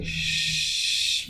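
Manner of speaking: sustained, forceful hushing exhale; forceful shh. Shown as a demonstration. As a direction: push the breath out through a long shh. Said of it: "very forceful".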